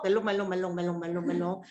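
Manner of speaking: low, sing-song 멜롱 run on one note; mock teasing chant; playful tongue-wagging. Teacher showing the tongue to the class, playful repeated chant.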